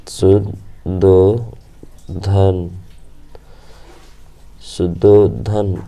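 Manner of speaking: Shuddhodana drawn out extremely slowly; very slow dictation. Shown as a demonstration. Teacher dictating Shuddhodana very slowly. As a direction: stretch every syllable of Shuddhodana.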